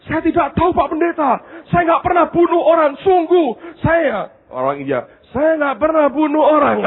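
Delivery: high, pleading nervous voice; frightened student. Retold or imitated. Scared Indian student answering the pastor, high and pleading.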